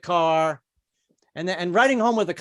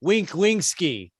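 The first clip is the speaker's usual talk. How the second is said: hammed-up mock Russian accent; slowing, shakily held Russian accent; 3 semitones higher than usual. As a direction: do a comic Putin in a rough Russian accent. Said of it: "a Russian accent".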